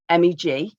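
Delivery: usual speaking voice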